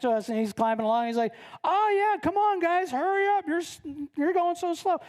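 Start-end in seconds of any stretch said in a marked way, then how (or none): 1.6-5.0 high-pitched breezy hurry-up call; impatient urging. Acted. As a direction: hurry the stragglers along, pitched up. Climber hurrying others along.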